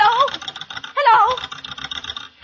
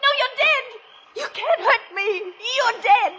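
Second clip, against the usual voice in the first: much higher, panicked shrieks; terrified screaming; 5 semitones higher, 4 dB louder than usual. Acted. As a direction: scream at a ghost. Sees dead man, terrified.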